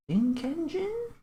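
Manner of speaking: very high, slow, soft squeak; a teasing, dragged-out squeaky guess; silly squeaky guess. Pretend. Mock guesses at picture.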